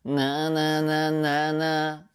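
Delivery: slow, sustained nasal "ななななな". Shown as a demonstration. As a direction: hold "ななななな" in the nose on one pitch. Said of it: "鼻の成分が強いですよね".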